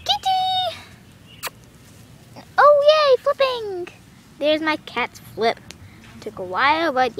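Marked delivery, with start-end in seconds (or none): 0.0-0.8 very high, drawn-out pet call; coaxing falsetto call; calling the cat. Real, not acted. 2.6-3.9 high, excited squeal; real excitement, pitched way up; delighted cheer. Real, not acted. Cat finally flips.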